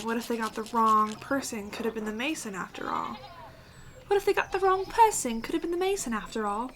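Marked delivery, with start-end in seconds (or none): 4.1-6.7 high-pitched, bad British accent. Game player rereading the villager's gossip, in a British accent. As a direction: put on a terrible British accent. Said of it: "felt British. And that was a terrible accent".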